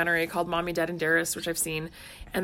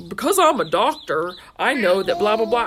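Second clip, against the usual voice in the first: loud, pompous mock doctor voice; loud smug quote of his line; mocking Doctor Phil; 9 dB louder than usual. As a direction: puff up like a self-important TV doctor. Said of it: "he says stuff like".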